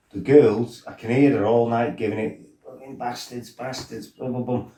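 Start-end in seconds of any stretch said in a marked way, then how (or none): 2.6-4.7 muttered insults. Retold or imitated. Girl muttering insults at the lads. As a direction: grumble insults quietly in the background.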